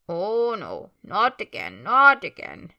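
put-on wailing old house-elf with big rising swoops; loud, swooping creature lament; Kreacher. House-elf grumbling to himself as he comes downstairs, muttering creature voice. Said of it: "muttering voice of Kreacher as he wheezes".

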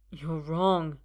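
shaky first "You're wrong". A narrator's reading. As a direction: barely dare to say it. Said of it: "Arthur says shakily".

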